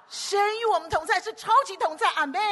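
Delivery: high, loud ringing declaration; full-force proclamation. Real, not acted. Preacher shouting to the congregation: loud, high ringing proclamation.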